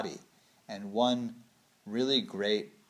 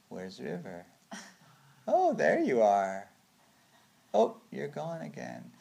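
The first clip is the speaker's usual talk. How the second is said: slow, drawn-out, half-hearted game; barely playing along; 4 semitones higher, slower than usual. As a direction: play hide-and-seek with one eye open. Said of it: "barely playing. I have one eye open".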